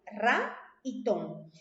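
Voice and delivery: "Ra y tón" slowly, each syllable separate; teacher's slow syllable count of "Ra y tón"; split into syllables. Shown as a demonstration. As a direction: sound out "Ra" and "tón" one at a time. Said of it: "una palabra que tiene dos sílabas".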